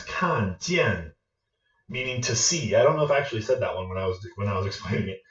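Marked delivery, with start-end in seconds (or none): 0.0-1.1 "Kan" and "jian" each falling; two fourth tones. Shown as a demonstration. Teacher giving viewers an example word, two falls. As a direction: drop firmly on "Kan", again on "jian". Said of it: "Two fourth tones".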